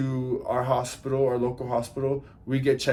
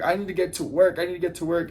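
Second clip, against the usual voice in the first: higher-pitched imitation of a distraught woman; mock tearful pleading; 5 semitones higher, faster than usual. Driver fretting about work after the crash, mock tearful pleading. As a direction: plead it high, half-mocking her tears. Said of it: "she starts hysterically crying and crying".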